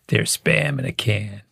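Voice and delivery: pilot voice. Retold or imitated. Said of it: "it's pilot voice".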